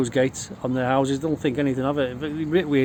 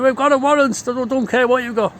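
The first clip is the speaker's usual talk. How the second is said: higher and louder, cops and mate shouting over each other; retold shouting match; 9 semitones higher, 3 dB louder than usual. Police and mate trading shouts at the door, loud. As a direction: bark the warrant, then brush it off. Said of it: "And he's going".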